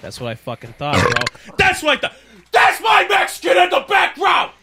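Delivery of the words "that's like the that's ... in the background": high-pitched full shout; really loud. Pretend. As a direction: yell it out high over everyone. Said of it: "Sorry, that was really loud".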